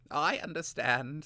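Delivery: high, slow, humble apprentice; bow your head and say it meekly. Acted. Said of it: "said Vilnix humbly".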